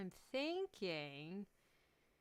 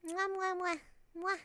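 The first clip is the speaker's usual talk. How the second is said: big wet smooch; 9 semitones higher, 5 dB louder than usual. Real, not acted. Blowing kisses goodbye to stream.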